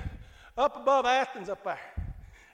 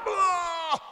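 high loud mock retching groan; preacher mimicking his wife retching in the car; 8 semitones higher, 6 dB louder than usual. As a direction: gag like being sick in the car. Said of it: "My kids will tell you".

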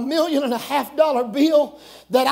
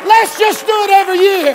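higher, louder rallying repeat; raised preacher's call; 6 semitones higher, 8 dB louder than usual. Real, not acted. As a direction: push it harder the second time.

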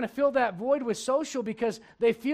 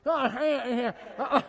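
mouth-open grunts. Retold or imitated. Imitating mouth-open sounds.

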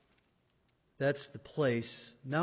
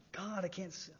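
awed hush; 4 semitones higher, 12 dB quieter than usual. Acted. Seraph shielding its eyes before God, hushed awe.

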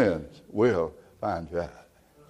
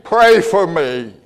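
higher, louder, drawn-out plea; drunk husband's plea; 8 semitones higher, 6 dB louder, slower than usual. Retold or imitated. Drunk husband asking his wife, higher pleading. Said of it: "and he said".

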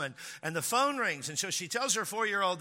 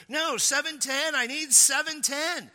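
high, loud, insistent plea; frantic; 10 semitones higher, 4 dB louder than usual. Retold or imitated. Frantic driver insisting to the puzzled attendant, high and loud. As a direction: repeat it louder, desperate to be understood.

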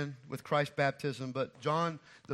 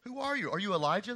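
higher, eager questioning crowd; 7 semitones higher than usual. Retold or imitated. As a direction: press him with questions. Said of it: "they asked".

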